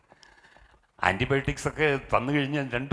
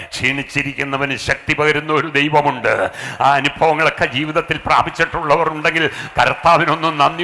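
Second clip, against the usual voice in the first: loud, higher, rising preacher's call; full-force preaching; 3 semitones higher, 9 dB louder than usual. Acted. Pastor rousing the congregation to thank God, loud preaching. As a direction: build it up and call out to the church.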